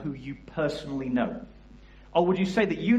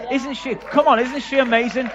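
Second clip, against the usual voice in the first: higher and much louder, whipping up the crowd; rallying cheer; 10 semitones higher, 9 dB louder than usual. Real, not acted. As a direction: get the room to cheer for her. Rallying crowd to praise girl.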